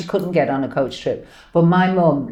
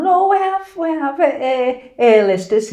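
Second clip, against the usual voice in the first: higher-pitched voice of the driver; imitated taxi driver; 7 semitones higher than usual. Taxi driver to mum and daughter, imitated. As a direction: put on a high local taxi-driver voice. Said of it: "he was going".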